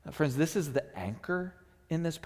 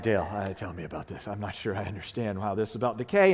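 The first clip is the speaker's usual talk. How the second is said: puzzled asking; 6 semitones lower than usual. Retold or imitated. To Dale at the easel, a low puzzled question. Asking artist friend to explain painting.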